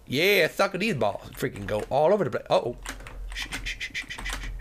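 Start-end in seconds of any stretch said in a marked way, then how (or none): 3.3-4.5 soft unvoiced shh; whispered shushing. Real, not acted. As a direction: hush the game tensely. Hushing during a tense moment.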